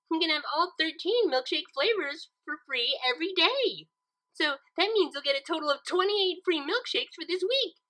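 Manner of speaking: high, bubbly pony offering treats; high bubbly pony consoling her friend with milkshakes; cheerful Pinkie. A narrator's reading. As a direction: sell it sweetly and cheerfully.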